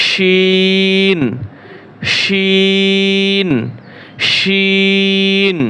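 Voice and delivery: শিন held very long with a clear শ; drawn-out letter. Shown as a demonstration. Teacher contrasting শিন with সিন for students. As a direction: stretch শিন slowly, not সি. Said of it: "আর হচ্ছে শি।".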